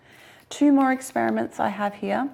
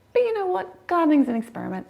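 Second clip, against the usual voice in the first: excited; 8 semitones higher, 4 dB louder, faster than usual. Real, not acted. Excited turn to try anyway.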